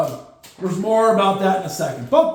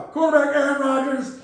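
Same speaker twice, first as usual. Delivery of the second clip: normal talking voice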